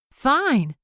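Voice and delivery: high, sharp yelp; a squeaky reluctant yelp. Acted. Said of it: "Annabelle yelped".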